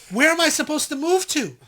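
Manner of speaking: high, loud exasperated comeback; mock indignant retort. Woman in train doorway challenging a pusher, mock indignation. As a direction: throw the question back, affronted and loud.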